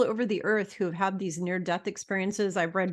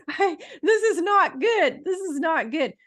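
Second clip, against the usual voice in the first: high, slow words still laughing; 9 semitones higher, 4 dB louder than usual. Real, not acted. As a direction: laugh your way through the words. Laughing at own situation.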